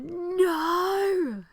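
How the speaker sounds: high, loud cry of horror; shocked cry. Retold or imitated. Friends watching Amy kill Griffin, crying out a high, loud no. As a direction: yell out in disbelief as your friend kills.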